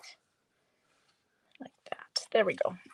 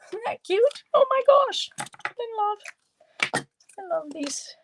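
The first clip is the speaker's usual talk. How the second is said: much higher, cooing squeal of delight; gushing delight; 14 semitones higher than usual. Real, not acted. Crafter adoring her little bee stamp, gushing. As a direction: gush over something adorable in a high voice.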